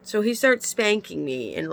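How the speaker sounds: ordinary talk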